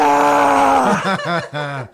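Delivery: long high shrieking yell; shrill scream. Retold or imitated. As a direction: let out a piercing scream. Crowd scream retold.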